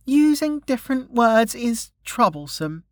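put-on high little-girl voice, protesting; grumbling child. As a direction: grumble back at Dad as a stubborn child. Daughter grumbles back at father.

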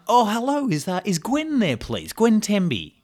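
fake phone company caller. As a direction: play a polite stranger ringing up. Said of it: "pretend to be someone from the phone company".

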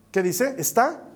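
ordinary talk, nothing marked

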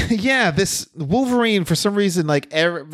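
usual speaking voice

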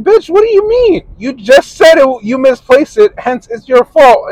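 high, loud, indignant rant; arguing back at his girlfriend in a high, loud mock rage; mock outraged. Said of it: "And I'm like".

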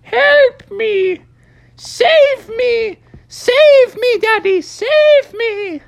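very high, loud tiny-creature cry; drawn-out high bleating plea; little voice crying for help. Acted. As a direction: squeal for rescue like a little animal. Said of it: "a little voice in the distance".

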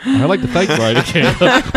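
high, laughing through the words; thanking guests while giggling. Real, not acted. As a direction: try to talk through the giggles. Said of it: "now they got the giggles".

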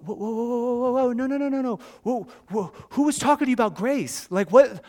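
high, rapid-fire mock panic; alarmed backpedalling. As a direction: hurriedly try to halt a child's tears. Startled father reacts to crying.

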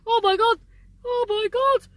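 real shock at a sudden sensation; blurting out in surprise; startled exclamation. Real, not acted. Sudden strange feeling in nose.

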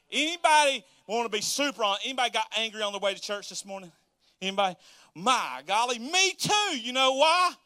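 usual speaking voice

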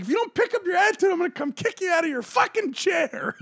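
high-pitched mock-angry threat, rushed into a shout; comically mad. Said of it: "comically being ma".

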